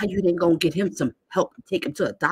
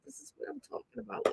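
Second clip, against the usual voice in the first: "This is what I'm talking about" said much softer, near whisper; hushed aside; 6 semitones higher, 16 dB quieter than usual. Real, not acted. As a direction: drop to a hushed, knowing aside. Drops to aside.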